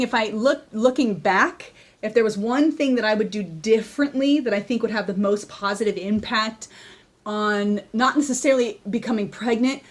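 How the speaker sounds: usual speaking voice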